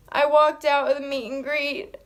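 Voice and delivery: crying through the words, voice breaking; real tears while telling it; tearful. Real, not acted. Fan retelling meeting [name], tearful and crying. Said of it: "I'm getting emotional right now talking about it".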